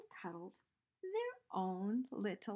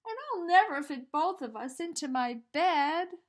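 put-on louder, higher boy voice insisting; loud declaring; 5 semitones higher, 10 dB louder than usual. A narrator's reading. Eddie tells the teddy there's no room, loud boy voice. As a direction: make it clear he won't fit. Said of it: "he said".

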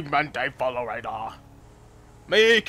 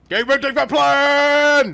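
higher, louder, slow caveman yell; excited caveman shout; 12 semitones higher, 10 dB louder than usual. Acted. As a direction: shout the big idea proudly. Caveman excitedly announces plan.

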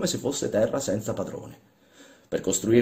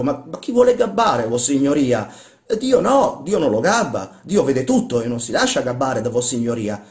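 high, loud indignant protest; vexed, getting heated; 5 semitones higher, 7 dB louder than usual. Acted. Workman arguing with his master about God, heated. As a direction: stammer and flare up in protest.

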